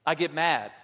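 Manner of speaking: usual speaking voice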